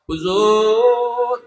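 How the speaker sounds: high, long-drawn appeal; pleading call. Acted. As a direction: draw the word out as a loud plea. Acted plea to the cleric.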